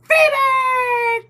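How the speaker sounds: delighted shout out to a viewer; Freebird! called high and loud; excited greeting. Real, not acted.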